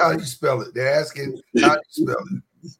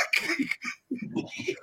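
breathy laugh; 12 semitones higher, 13 dB quieter than usual. Real, not acted. Laughing at the chat.

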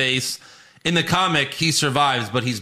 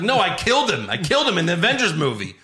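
higher-pitched, lightly indignant protest; mock pissed off; 6 semitones higher, faster than usual. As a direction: imitate an aggrieved producer objecting. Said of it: "he was just pissed off".

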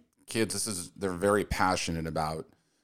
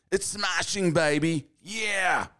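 high, hammed-up British accent; mock-British exclamation, pitched way up; 9 semitones higher, slower than usual. Podcaster signing off a betting ad in a hammed-up British accent. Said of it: "the British accent makes sense there".

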